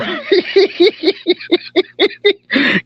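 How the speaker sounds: a real burst of high, helpless laughter; giggling at the chat; high-pitched laughing fit. Real, not acted. Cracking up at a comment.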